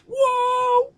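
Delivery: high squeal of surprise; real startled whoop; surprised whoa. Real, not acted. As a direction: shriek it in sudden surprise. Sudden mate found.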